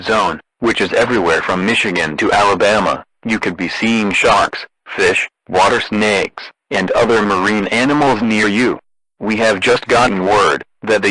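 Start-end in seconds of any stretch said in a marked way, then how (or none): none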